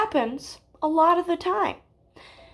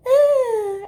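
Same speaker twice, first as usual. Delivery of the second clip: higher, louder imitated moan; mock moan; 8 semitones higher, 5 dB louder than usual. Telling how Casey moans aloud, mock moan. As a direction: draw out a pretend "ah" moan. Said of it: "and she moans".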